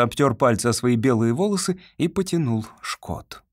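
usual speaking voice

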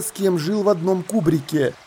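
ordinary delivery

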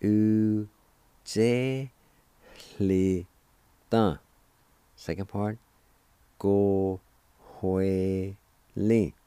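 emphatic teacher demo; loud syllables. Shown as a demonstration. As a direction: call out each syllable clearly for the class. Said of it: "First part is pronounced like this".

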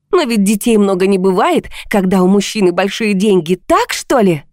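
high-pitched bitter tirade; heated, scornful outburst; angry shouting. A narrator's reading. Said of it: "теперь и я кричала".

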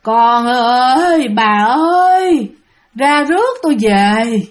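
drawn-out, haunting call from the dead; eerie ghostly wail. Retold or imitated. The dead man calling his family to fetch him, eerie wail. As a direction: stretch every word into a ghostly moan. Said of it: "cái tiếng kêu này thấy ghê lắm".

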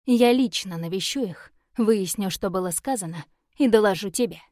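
usual speaking voice

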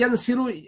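ينسر said "yansiru", with i after the s; kasra on the middle letter. Shown as a demonstration. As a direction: say ينسر as yan-SI-ru. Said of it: "لا". (نطق ينسر بكسر العين: يَنْصِرُ)

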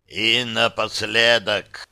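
slow, rasping altered voice; hoarse changed voice. Acted. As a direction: speak as if something else took over. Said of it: "изменившимся хрипловатым голосом". (хрипловатый чужой голос)

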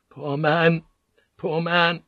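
high-pitched, hushed pity; horrified whisper. A narrator's reading. Crewman mourning the dead man, high horrified whisper. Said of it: "Van Neck whispered over the horrified silence".

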